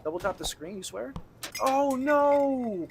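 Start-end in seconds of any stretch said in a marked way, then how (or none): 1.6-2.9 sudden high, loud "oh no"; dismayed cry. Real, not acted. Streamer yelping at a sudden in-game fail. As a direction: yelp at a gaming setback.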